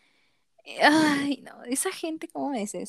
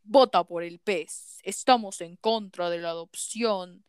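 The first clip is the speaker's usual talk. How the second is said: slow, lowered voice mocking a conservative radio ad; mock political ad; 3 semitones lower than usual. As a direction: ham up a preachy campaign spot, slow and heavy. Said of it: "escuché un comercial". (imitando con burla un anuncio político, lento y grave)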